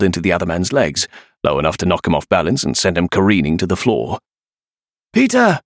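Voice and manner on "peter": high-pitched shout of a name; urgent call. A narrator's reading. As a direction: call to a friend mid-fight. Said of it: "Kira called".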